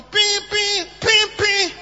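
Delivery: mock doorbell ping; doorbell imitation. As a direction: ring it out like a doorbell.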